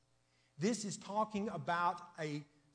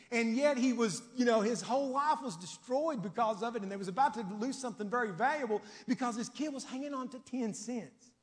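loud, high, fervent preaching; preaching at full force; 6 semitones higher, 6 dB louder than usual. Acted. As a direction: lift the whole church with it. Preaching climax on the story.

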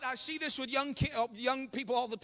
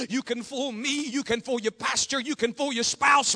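ordinary talk, nothing marked

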